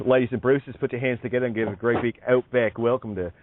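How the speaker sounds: Aussie emcee's accent; Australian accent. Shown as a demonstration. Demonstrating an Outback welcome to a co-host, Australian accent. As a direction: introduce a guest to the crowd in broad Australian. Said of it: "the Australian accent is".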